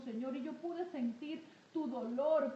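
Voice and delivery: usual speaking voice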